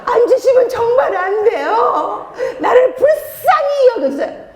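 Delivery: high, drawn-out pleading wail; exaggerated, loud-pitched begging prayer; mock begging orphan. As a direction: plead like a desperate orphan, hammed up. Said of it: "고아처럼 부르짖지 마세요 ... 애걸복걸".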